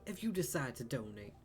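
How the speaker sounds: put-on improvised southern accent, a little lifted; light southern accent. Game character asking player for donations, light southern accent. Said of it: "We're learning southern accents".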